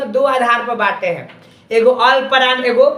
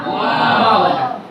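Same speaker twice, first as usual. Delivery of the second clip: drawn out; 3 semitones lower, much slower than usual. Shown as a demonstration. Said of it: "मिलकर के क्या बना?".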